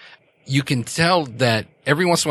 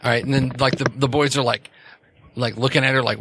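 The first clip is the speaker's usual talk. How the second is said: ordinary talk, nothing marked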